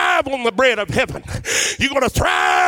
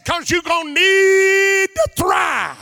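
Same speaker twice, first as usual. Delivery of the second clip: preaching shout; 7 semitones higher, slower than usual. Acted.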